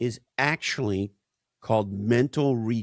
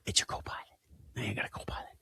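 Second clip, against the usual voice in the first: mock whisper; 12 dB quieter, much faster than usual. Mocking vendors' AI marketing talk.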